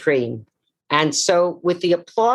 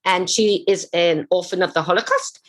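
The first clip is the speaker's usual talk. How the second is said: usual speaking voice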